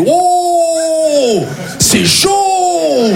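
high, long-drawn-out cry of alarm; mock alarmed exclamation. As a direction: stretch each exclamation out high. Acts friend's reaction on phone. (exclamation aiguë et traînante)

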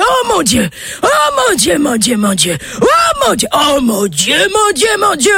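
high, shrill-pitched cries of rapture; ecstatic screaming. Acted. Old man groping the girl, screaming in ecstasy. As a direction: howl it out like he's overwhelmed with joy. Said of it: "il se met à hurler".

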